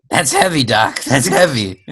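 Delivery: stunned young time-traveler impression; awed movie-teen voice; Michael J. Fox. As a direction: play the astonished young sidekick. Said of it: "you had a Michael J. Fox".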